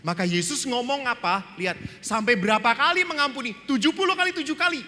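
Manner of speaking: high, loud, fast preacher's burst; emphatic preaching. Real, not acted. Priest stirring the congregation, loud and fast. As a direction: drive home the count of forgiveness.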